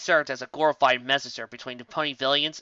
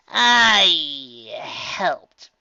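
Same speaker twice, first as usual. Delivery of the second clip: reluctant admission; 3 semitones higher, 6 dB louder than usual. A narrator's reading. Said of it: "Tracy decided after a moment".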